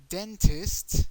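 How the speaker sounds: "Dentist" high and loud, DEN stressed; English "Dentist" ending in a crisp -ist; English word demo. Shown as a demonstration. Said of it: "instead of "ist," it's going to be "ista".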